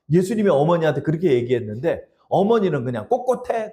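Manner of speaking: ordinary talk, nothing marked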